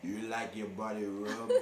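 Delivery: badly faked Russian accent with a laugh in it; horrible Russian accent. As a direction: do a terrible Russian masseuse accent. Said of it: "it was a horrible accent".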